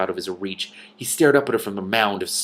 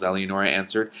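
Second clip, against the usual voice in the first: ordinary delivery, nothing marked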